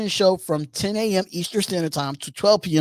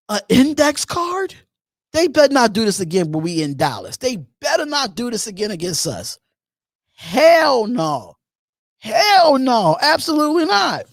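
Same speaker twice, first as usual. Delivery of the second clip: livid; 6 semitones higher than usual. Retold or imitated. Fan reliving a bad Cowboys game, livid and incredulous. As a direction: relive the outrage, voice climbing on the refusals. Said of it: "I was livid that game".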